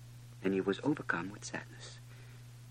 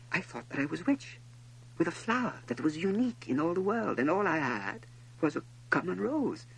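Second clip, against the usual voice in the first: lightly voiced prince, higher pitched; higher, understated lament of a young prince; disillusioned little prince; 8 semitones higher than usual. A narrator's reading. Prince reflects on his common rose, mildly disillusioned.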